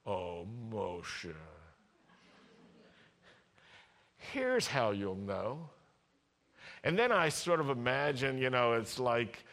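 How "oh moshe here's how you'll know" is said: low, soft, slow tenderness; sweet and tender. Acted. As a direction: speak like to a beloved child. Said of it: "sweet and tender".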